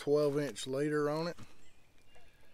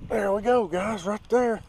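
excited catch; 8 semitones higher, 6 dB louder than usual. Real, not acted. Another fish hooked on camera.

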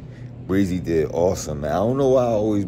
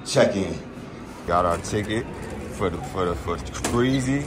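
ordinary delivery

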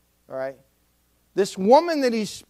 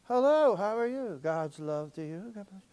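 slow gentle greeting; 7 dB quieter than usual. Retold or imitated. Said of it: "Tom is just like".

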